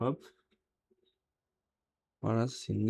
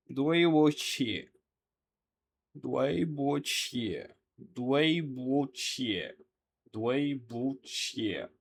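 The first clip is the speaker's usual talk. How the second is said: drawn-out, syllable by syllable; slow pronunciation model; 2 semitones higher, much slower than usual. Shown as a demonstration. Teacher repeats the apology for learners, slow and drawn-out. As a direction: stretch each syllable slowly for learners.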